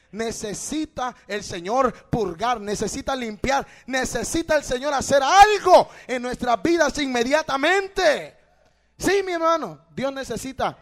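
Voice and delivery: rising to a full-force pulpit cry; fiery preaching. Real, not acted. Preacher builds to a loud climax. (predicación encendida que sube a gritos)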